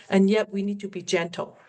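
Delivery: ordinary delivery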